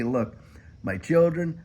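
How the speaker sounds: usual speaking voice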